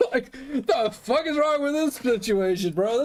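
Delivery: real laugh breaking through the words, high and slow; laughing in disbelief. Real, not acted. Telling a friend about the guns out, laughing. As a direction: laugh it out, stunned. Laughing at seven cop cars.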